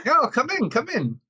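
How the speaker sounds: high, loud inviting call; eager welcome. Acted. Guildmaster inviting Vash into the room, eager welcome. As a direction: usher them in with a high-pitched voice.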